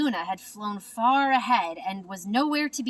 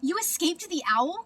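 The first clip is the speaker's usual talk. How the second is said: higher, amazed cry; excited cry; 8 semitones higher than usual. A narrator's reading. Stellaluna crying out to her found mother, higher and amazed. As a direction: burst out with joyful surprise. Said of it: "cried Stellaluna".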